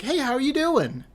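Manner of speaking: high, perky cashier greeting; cheery register girl. Retold or imitated.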